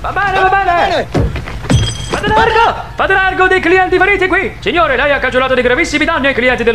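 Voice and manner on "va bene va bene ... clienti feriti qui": calling out. Acted. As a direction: clear the crowd with a raised voice. Lawyer pushing through crowd, calling out. (gridando alla folla)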